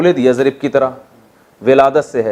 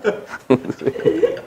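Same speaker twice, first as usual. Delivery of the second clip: a high airy burst of laughter; amused high laugh; 13 semitones higher, 7 dB quieter than usual. Real, not acted. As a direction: laugh at the punchline. Laughing at own story.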